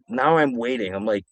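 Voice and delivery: ordinary talk, nothing marked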